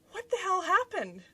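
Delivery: high-pitched imitation of husband's disbelief; startled husband. Husband finding his wife covered in egg, high startled voice. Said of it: "he looks over at me and he says".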